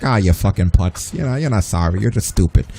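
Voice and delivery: a hint of a New Yorker scoffing, dismissive; New York accent. Scolding a fake apologizer, in a light New York accent. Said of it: "the New Yorker in me".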